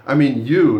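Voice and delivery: "you" hit loud, then falling; stress on "you". Shown as a demonstration. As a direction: punch "you" to single out the listener. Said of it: "not someone else".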